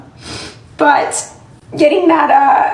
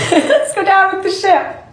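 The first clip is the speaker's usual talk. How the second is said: laughing; 5 semitones higher than usual. Real, not acted. Laughing defiant joke to fans.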